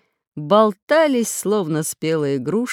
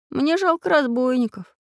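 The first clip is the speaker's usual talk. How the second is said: lightly voiced boy, higher pitch; boy's reply; 7 semitones higher than usual. A narrator's reading. Boy admits to teacher he pities robbers, boyish voice. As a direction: lift the voice into a young boy's. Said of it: "ответил Прутик".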